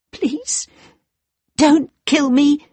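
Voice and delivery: put-on high, pleading young girl. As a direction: beg for her life. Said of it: "she said".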